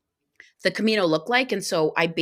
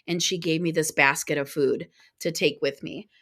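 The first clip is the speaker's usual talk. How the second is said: normal talking voice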